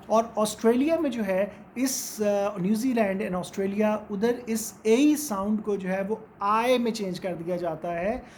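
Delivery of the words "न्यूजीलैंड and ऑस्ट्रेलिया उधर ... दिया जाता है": ordinary talk, nothing marked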